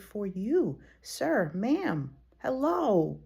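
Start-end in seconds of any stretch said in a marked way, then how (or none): none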